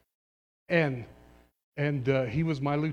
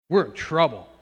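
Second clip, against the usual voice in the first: louder, pressing warning to the congregation; 3 semitones higher, 11 dB louder than usual. Acted. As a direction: sound the alarm on trouble.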